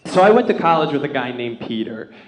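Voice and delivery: usual speaking voice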